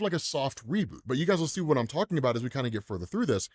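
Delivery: ordinary talk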